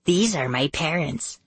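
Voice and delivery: put-on whispery girl. A narrator's reading. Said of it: "in her whispery voice".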